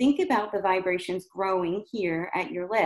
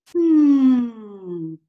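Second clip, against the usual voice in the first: louder sighing hum, gliding down; 3 semitones higher, 8 dB louder than usual. Shown as a demonstration. Coach demonstrating the sigh hum again. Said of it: "So you can try the hum".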